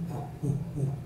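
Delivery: low, soft, breath-like whoos; imitated bear huffing. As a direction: huff softly like a calmed bear. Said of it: "that bear bent down and went".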